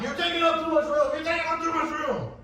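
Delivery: high-pitched yelled complaint; imitated shouting. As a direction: shout it like an indignant rival sailor. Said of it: "this guy's always shouting".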